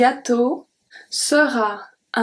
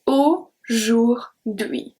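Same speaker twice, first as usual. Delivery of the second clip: Aujourd'hui spoken very slowly, syllable by syllable; slow model word; much slower than usual. Shown as a demonstration. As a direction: stretch out Aujourd'hui slowly for learners. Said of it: "make the sound E".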